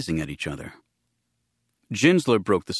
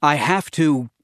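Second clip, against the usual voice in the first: louder and higher, pleading; raised, urgent insistence; desperate plea; 6 semitones higher, 5 dB louder, slower than usual. A narrator's reading. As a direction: burst out with need. Desperate plea to see ship.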